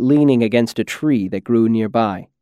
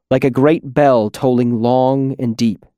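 ordinary delivery, nothing marked

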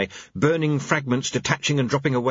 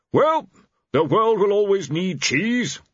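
much higher, louder, strained; desperate; 10 semitones higher, 3 dB louder than usual. Acted. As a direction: grasp at anything to say. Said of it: "said War desperately".

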